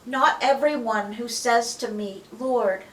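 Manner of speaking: slow, loud solemn Bible reading; scripture reading. Acted. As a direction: proclaim it slowly and loud. Reads Jesus's words aloud.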